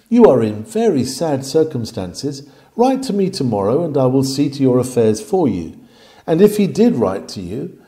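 normal talking voice

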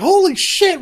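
high, loud mock shock; startled. Friends watching her run at them, startled. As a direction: blurt it out in alarm. Acted reaction as friend runs out.

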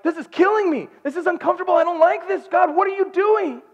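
high, loud cry to God; anguished complaint. Retold or imitated. Husband waiting in uncertainty cries to God, anguished complaint. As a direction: protest upward in strained desperation. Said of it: "I felt like Paul in prison".

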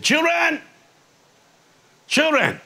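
put-on high drawn-out call of children; old preacher's call. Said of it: "he talks like that".

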